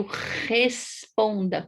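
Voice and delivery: "responda" with a long rasped first R; drawn-out guttural R opening "responda"; strong initial R. Shown as a demonstration. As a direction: lean hard on the throaty R of "responda". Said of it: "com o R no começo".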